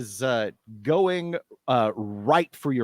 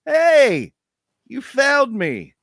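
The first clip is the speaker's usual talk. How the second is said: high, loud eerie fake-friendly call; a beast faking a friend's voice, pitched high and loud; monster mimicking Hutch; 13 semitones higher, 7 dB louder, slower than usual. The beast lures Fish in Hutch's voice, high, loud, uncanny. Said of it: "it's speaking in Hutch's voice".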